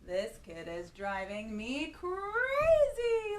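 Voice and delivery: hammed-up frazzled mom; exasperated mother. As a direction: play the fed-up mum. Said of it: "his mother would say".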